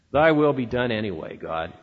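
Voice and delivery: mildly resigned, fading low. Shown as a demonstration. Preacher voicing a grudging believer, resigned and fading. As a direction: let the words sag into a grumble. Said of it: "a tone of resignation".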